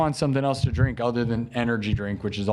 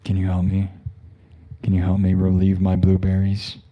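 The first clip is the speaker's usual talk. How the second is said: mock seductive plea; 7 semitones lower than usual. Continues the seductive bit.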